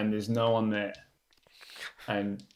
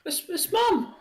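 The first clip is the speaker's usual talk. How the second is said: put-on high child's voice, pleading; a little boy begging with puppy dog eyes. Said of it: "looks up at you with, like, little puppy dog eyes and goes".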